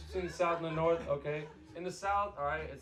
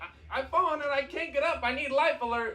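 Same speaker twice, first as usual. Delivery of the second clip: put-on old lady from the commercial; 5 semitones higher than usual. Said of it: "You remember that commercial?".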